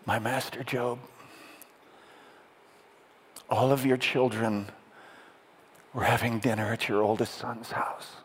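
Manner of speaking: subdued, halting delivery with long pauses; quiet, sorrowful messenger; composed grief. Acted. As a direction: break the worst news gently, phrase by phrase. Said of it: "he finally composes himself".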